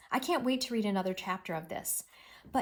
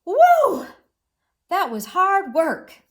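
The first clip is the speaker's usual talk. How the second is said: loud high woo, then much louder and higher; exclaiming; 9 semitones higher, 13 dB louder, slower than usual. Shown as a demonstration. Teacher shows Mole's real exclaiming reading, loud. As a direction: belt it out with the exclamation point. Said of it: "He went".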